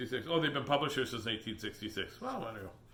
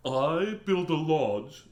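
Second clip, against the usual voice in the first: beaver voice; 3 semitones higher, 8 dB louder, much slower than usual. Acted. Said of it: "says the beaver".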